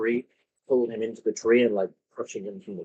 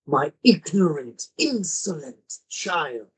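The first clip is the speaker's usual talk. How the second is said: commanding ultimatum; 4 semitones higher, slower than usual. Acted. GM voicing grove ruler's ultimatum.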